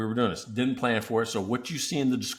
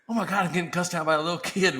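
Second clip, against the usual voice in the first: amused disbelief; 7 semitones higher, 4 dB louder, faster than usual. Retold or imitated. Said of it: "I'm, like, going".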